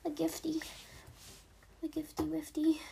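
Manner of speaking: put-on high cutesy baby talk, trailing into a whisper; uwu voice. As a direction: coo it like a cutesy uwu kid. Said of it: "I'm talking so uwu".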